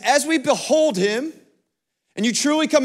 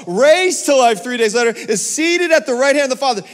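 rising, louder preaching list; 3 semitones higher, 4 dB louder than usual. Acted. Pastor proclaiming Christ to the congregation, preaching with rising force. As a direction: build each phrase like a sermon climax.

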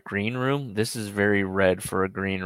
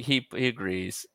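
ordinary delivery

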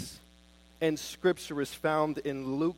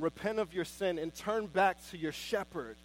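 ordinary delivery

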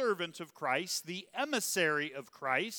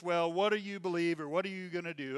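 mild mock question; 3 semitones higher, 4 dB louder than usual. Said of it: "It's not just".

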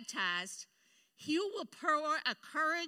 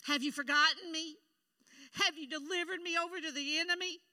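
whining; 5 semitones higher, 3 dB louder than usual. Retold or imitated. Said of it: "He was whining instead of praying".

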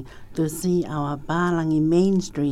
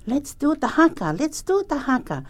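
put-on high, eager children's voice; excited kids voice; 9 semitones higher, 4 dB louder than usual. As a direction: voice the excited boys. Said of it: "stories that these Palangi boys did".

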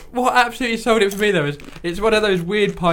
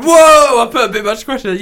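big loud "Whoa", then quick admission; high mock shock dropping into a hurried aside; mock alarm; 4 semitones higher, 5 dB louder than usual. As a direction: exclaim it as an imagined startled pourer. Voices what anyone else would say.

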